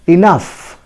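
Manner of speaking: "enough" said ih-NUF; pronunciation demo. Shown as a demonstration. Teacher modelling for learners, "enough" as ih-NUF. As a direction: say "enough" with a final f sound. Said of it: "pronounced as".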